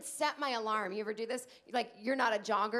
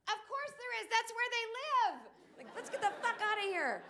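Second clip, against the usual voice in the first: much higher, laughing panic; panicked mom; 11 semitones higher than usual. Retold or imitated. To her child scared of a monster, high panicked laughing. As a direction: freak out with the kid, high and giggly. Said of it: "I would be like".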